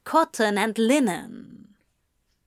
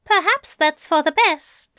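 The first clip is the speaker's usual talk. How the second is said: high, louder, lilting character voice; pleased angel in a raised voice; smiling angel; 7 semitones higher, 5 dB louder than usual. A narrator's reading. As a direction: say it lightly, pitched up, with a smile. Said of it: "Aziraphale smiled".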